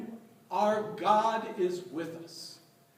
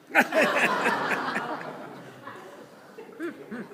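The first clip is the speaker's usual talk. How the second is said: real laugh; 5 semitones higher than usual. Real, not acted. Punchline of own story.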